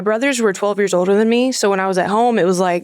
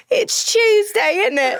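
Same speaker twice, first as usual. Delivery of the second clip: high, drawn-out mock British; British accent; 11 semitones higher, slower than usual. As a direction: stretch it out in comic British. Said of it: "everything is more interesting in a British accent".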